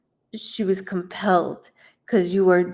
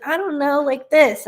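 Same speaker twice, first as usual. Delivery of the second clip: put-on higher, louder girlish shrug; mock the unsure teen, pitched up; imitating daughter; 6 semitones higher, 4 dB louder than usual. Daughter shrugging off her mom, higher and louder. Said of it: "She's like".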